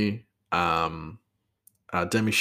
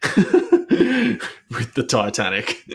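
laughing; 3 semitones higher, 4 dB louder, much slower than usual. Real, not acted. Laughing at his own joke.

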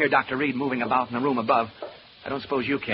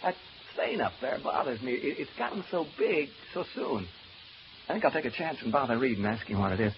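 usual speaking voice

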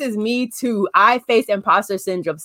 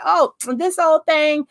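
mock modest brush-off; 6 semitones higher, slower than usual. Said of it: "The third imposter syndrome is the".

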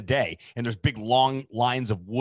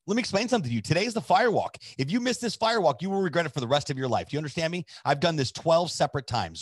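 emphatic, higher-pitched telling-off; retold scolding; 6 semitones higher, faster than usual. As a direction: lay down the law, imitating her. Friend scolds him in retold call.

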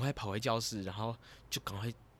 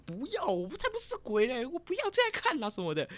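put-on much higher, squeaky denial like a sulky kid; high whiny protest; 14 semitones higher than usual. Dreamer refusing the voice in her head, in a high whiny protest. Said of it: "我就说".

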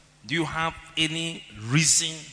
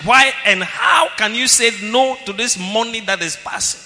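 loud preaching; 5 semitones higher, 10 dB louder than usual. Acted. Preacher challenging the congregation.

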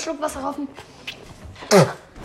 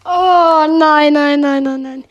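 shocked outcry; 2 semitones lower, 10 dB louder, slower than usual. Real, not acted. Reacting to moldy candy in mouth.

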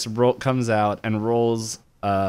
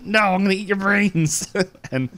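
goofy villain voice; 5 semitones higher than usual. Retold or imitated. Monster threatens to eat brains.

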